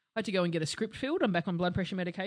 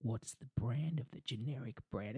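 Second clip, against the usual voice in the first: low, quiet deadpan question; dry and unimpressed, no expression; flat deadpan, low and soft; 6 semitones lower, 9 dB quieter, slower than usual. Retold or imitated. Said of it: "I just deadpanned her".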